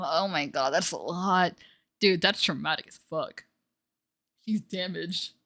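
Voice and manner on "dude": ordinary delivery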